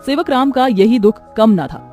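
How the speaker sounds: plain reading voice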